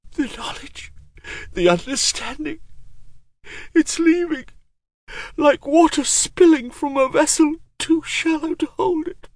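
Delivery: put-on high, strained old sorcerer voice; an ancient mage losing his mind; raspy whisper. A narrator's reading.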